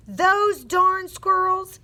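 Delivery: put-on high, loud, drawn-out old man's yell; yelled in story. A narrator's reading. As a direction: yell it slowly at the squirrels. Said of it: "yelled Old Man Fookwire".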